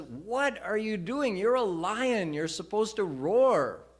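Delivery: high, loud big-lion scolding; incredulous elder lion, rising loud; scolding older lion. Retold or imitated. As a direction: scold the cub to wake him up. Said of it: "older lion says".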